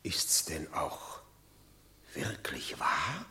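leise, langsam geflüstert, kaum zu glauben; ungläubiges Flüstern. Acted. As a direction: hauche die Frage ungläubig. Ungläubige Frage nach der Nachricht. (fassungslos geflüsterte Frage an den Boten)

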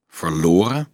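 student doubting Dolf's story, sharp and suspicious; sharp question. A narrator's reading. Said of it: "vroeg Leonardo scherp".

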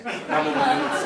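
high, scornful exclaim; disgusted punchline. Real, not acted. Comedian sums up the ruined dish, scornful and laughing. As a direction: spit out the verdict with a laugh.

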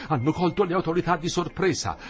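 alarmed. Acted. Old man alarmed.

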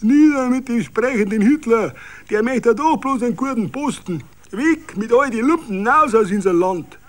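put-on high, loud drunken roar; drunken bellowing. A narrator's reading. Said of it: "Berauschte brüllten noch".

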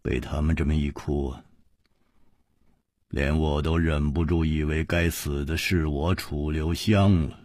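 low, soft, wry self-blame; quietly resigned and rueful. A narrator's reading. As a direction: say it with a bitter little laugh implied. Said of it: "楚留香苦笑着说".

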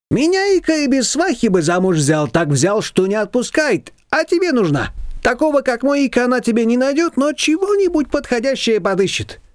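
high, lively neighbour voice; Shushana's voice. A narrator's reading. Neighbour brushing off Nina, high and bossy.

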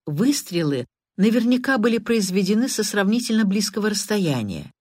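ordinary delivery

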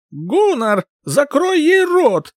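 high, slow roared command; roaring tyrant king. Acted. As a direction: roar the order at the mage. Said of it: "король взревел".

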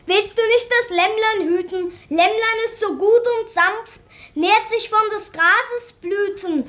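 put-on a child's dutiful poem recital; child reciting a poem. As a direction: recite it like a good pupil. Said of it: "sag dem Tantchen etwas auf".

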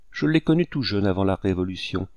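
plain reading voice

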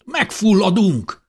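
high, loud, stretched-out cry; panicked shout. A narrator's reading. Man waving at thick smoke warns the others, shouting. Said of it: "kiáltotta Káleb bátyja".

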